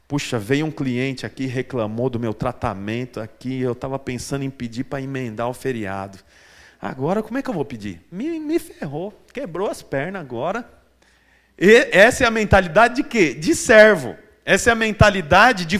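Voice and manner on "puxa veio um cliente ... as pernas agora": much lower and softer, a fretful worker thinking aloud; worried employee. Pretend. Preacher voicing an anxious employee, lower and softer. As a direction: mutter it like a guilty employee. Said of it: "Funcionário pensa assim".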